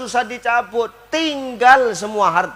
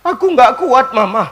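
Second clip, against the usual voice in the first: loud mock lament of a husband; tangisan makin kuat; 2 semitones higher, 12 dB louder than usual. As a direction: cry it out, overcome, hammed-up. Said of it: "makin kuat nangisnya". (ratapan keras pura-pura)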